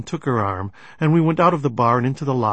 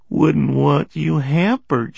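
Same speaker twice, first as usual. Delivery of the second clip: slow, raised, coquettish tease; tipsy flirting; 4 semitones higher, 4 dB louder, slower than usual. A narrator's reading. Giggling woman bumping his knee, flirtatious. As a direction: stretch it out high and flirty.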